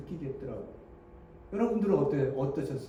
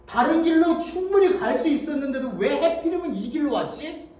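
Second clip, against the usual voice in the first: usual speaking voice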